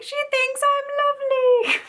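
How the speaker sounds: very high, loud delighted squeal; giddy squeal. Retold or imitated. Reading the autograph, very high giddy squeal. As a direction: gush like you've been complimented. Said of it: "I was like".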